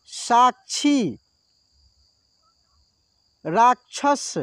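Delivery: क्ष words one by one, each said slowly and clearly; slow word drill. Shown as a demonstration. Teacher drilling pupils on क्ष words, slow and clear. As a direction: spell out रक्षा, कक्षा, भिक्षा for the learners. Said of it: "थोड़ा सा ध्यान से समझना है".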